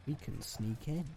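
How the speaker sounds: usual speaking voice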